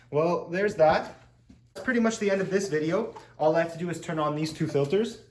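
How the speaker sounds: usual speaking voice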